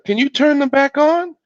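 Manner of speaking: put-on high-pitched little boy; Little Bill's child voice. A narrator's reading. Little Bill asks mother.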